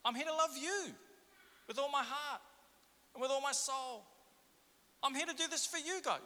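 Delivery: high, whiny pious protest; mock pious protest. Churchgoer protesting to God in a high mock-pious whine. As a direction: plead your devotion in a high whine to dodge the task.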